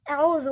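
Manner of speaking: أعوذ opened with a thick, dark "aw"; over-thicken the first sound of أعوذ; أعوذ with an emphatic, backed initial vowel; heavy hamza mistake. Shown as a demonstration. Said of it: "هنا في ناس بتقول".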